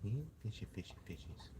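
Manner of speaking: soft hushed whisper to the fish. Real, not acted. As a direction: breathe the words almost silently.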